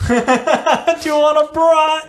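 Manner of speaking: high, drawn-out, gleeful quote; laughing repeat. Retold or imitated. Listener savours the friend's cheeky offer, laughing. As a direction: repeat it slowly through high-pitched amusement.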